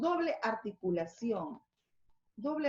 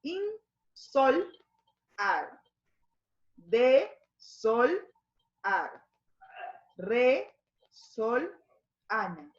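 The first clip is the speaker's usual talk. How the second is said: "in, sol, ar" broken apart, the root "sol" pushed louder; syllable by syllable; 4 dB louder, much slower than usual. Shown as a demonstration. Teacher splitting words into parts for the class. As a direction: split each word into prefix, root and suffix with pauses. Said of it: "Tenemos acá".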